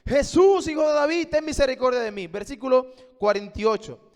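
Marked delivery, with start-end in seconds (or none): 0.0-2.3 louder, higher pleading cry; a blind beggar shouting over the crowd; retold cry for mercy. Bartimeo crying out over the crowd for mercy, a louder, higher pleading cry. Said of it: "intenta gritar más que toda la multitud".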